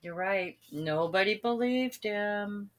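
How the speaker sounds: usual speaking voice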